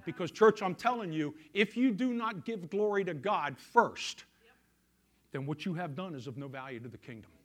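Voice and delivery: ordinary talk, nothing marked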